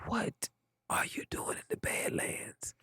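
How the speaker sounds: secretive phone whisper, breath only. Retold or imitated. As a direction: whisper it like someone might overhear. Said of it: "he started whispering on the phone".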